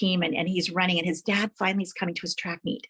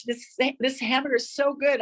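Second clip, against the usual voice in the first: higher, delighted craving voice; picturing a big juicy burger, higher, delighted gushing; excited about food; 6 semitones higher than usual. Pretend. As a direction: gush like a hungry kid. Imagining eating a hamburger.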